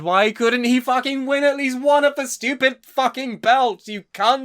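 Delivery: high, loud, outraged yell at the bookers; furious rant. Real, not acted. Fan yelling at the bookers, furious and high. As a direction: rage at the people who ruined the match.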